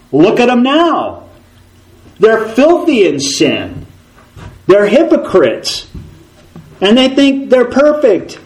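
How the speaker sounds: high, sneering accuser voice; Satan mocking God's people, high and scornful; Satan accusing.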